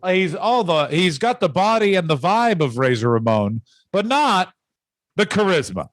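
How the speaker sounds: ordinary talk, nothing marked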